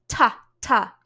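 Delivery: "Ta, ta" short, loud and explosive; really harsh T. Shown as a demonstration. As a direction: spit out a hard T on each "ta". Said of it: "do a really harsh T".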